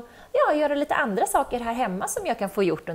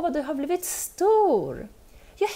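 usual speaking voice

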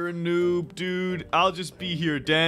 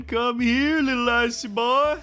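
higher, louder playful coaxing; teasing put-on voice; 6 semitones higher, 4 dB louder than usual. Player stalking the icy guy, teasing put-on voice. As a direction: lure them in with a silly raised voice.

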